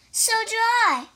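high, excited character voice; eager reply. Acted. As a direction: chirp it out eagerly. Toad answers in character.

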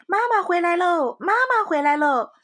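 high, loud happy cry; excited calling. A narrator's reading. Little rabbits call out to mother, high and loud. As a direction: shout for joy that mom is home. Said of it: "就一起叫了起来".

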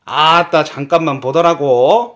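loud Jeolla dialect; a showy Jeolla dialect demo. Shown as a demonstration. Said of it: "전라도 사투리로는".

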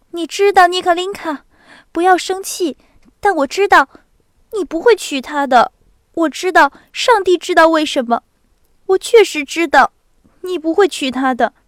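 高而响的急切劝说; 认定自己知道一切的妹妹; 热切的少女. A narrator's reading. 娜达莎劝哥哥. (热切恳求的少女)

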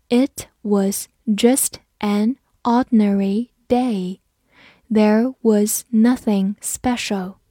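slow, word-by-word teaching read; slow model reading. Shown as a demonstration. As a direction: read it slowly, pausing between words. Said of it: "我们慢读一遍". (慢速逐词朗读)

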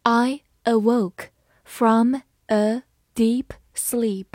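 slow, word by word read-along; slow dictation pace; slowly. Shown as a demonstration. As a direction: lay out each word slowly for learners. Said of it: "好，慢慢来读。".

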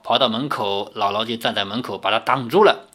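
ordinary delivery

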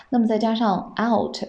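ordinary delivery

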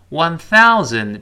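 "thousand" slow with the tongue out on th; clear th. Shown as a demonstration. Teacher slows down to show the th sound. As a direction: stretch out "One thousand" with a careful th. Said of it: "注意thousand，th".

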